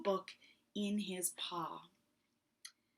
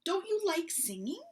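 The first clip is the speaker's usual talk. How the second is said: puzzled mouse; 9 semitones higher, 4 dB louder, faster than usual. Acted. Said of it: "asked Mouse".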